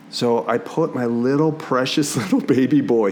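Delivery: ordinary talk, nothing marked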